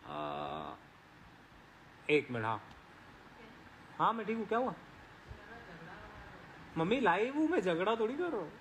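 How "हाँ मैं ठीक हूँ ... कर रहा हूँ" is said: calling out to mom. Real, not acted. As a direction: call back to mom reassuring her. Answers mother calling during live. (Mummy को ऊँची आवाज़ में जवाब)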